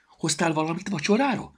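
high, eager little squirrel. A narrator's reading. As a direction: chirp the question like a hungry squirrel. Squirrel asks about dinner.

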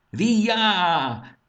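high, loud piercing screech; shrieking bird cry. A narrator's reading. Kfesson the lizard-bird leader screeching as he attacks. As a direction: shriek like a diving hawk. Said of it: "visított Kfesson".

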